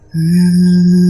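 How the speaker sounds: high, loud sustained whine; imitated crying. Showing who wept while knocking on window, imitated wail. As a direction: hold a high wordless ghostly wail. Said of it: "yang nangis tadi kelihatan".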